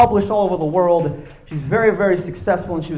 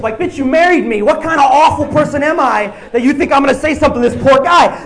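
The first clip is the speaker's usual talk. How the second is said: ordinary talk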